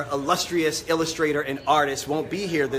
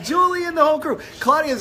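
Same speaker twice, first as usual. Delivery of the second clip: excited shout; 12 semitones higher, 5 dB louder, faster than usual. Real, not acted. Greeting viewers, shouting.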